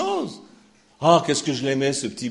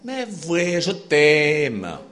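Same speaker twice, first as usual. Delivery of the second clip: put-on Swiss accent; 2 semitones higher, 5 dB louder, much slower than usual. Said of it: "Je prends mon accent suisse".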